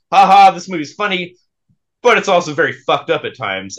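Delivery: a short laugh, then a flat turn on fucked up; funny at first, then catch the dark side; amused then sobered. Retold or imitated. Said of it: "when I watched it in real life".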